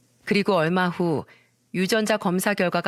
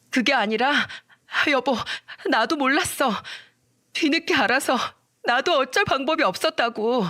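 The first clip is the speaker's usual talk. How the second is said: higher, mildly voiced desperate begging; pleading wife; 6 semitones higher than usual. Wife begs husband after being caught, higher, pleading. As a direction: plead on your knees, voice lifted. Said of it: "무릎을 꿇고 빌면서 애원하며".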